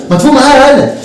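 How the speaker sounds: mock scolding. Teacher snapping at sleepy student. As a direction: snap at him to wake up.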